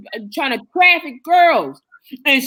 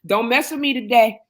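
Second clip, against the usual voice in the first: usual speaking voice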